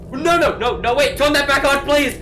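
loud, high, frantic yell; panicked pleading. Real, not acted. Player begging the game to turn it back on, panicked. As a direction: beg in a real panic, voice shooting up.

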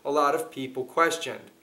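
ordinary talk

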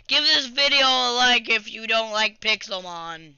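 ordinary talk

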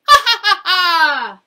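loud, high ha ha ha laughter; hearty laugh. Acted. The animals urging Jim to laugh, loud laughing. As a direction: laugh it out big to show how.

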